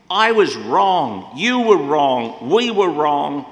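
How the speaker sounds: slow, loud preaching emphasis; emphatic, slow and loud. Acted. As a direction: hammer each phrase out slowly. Confession building in sermon.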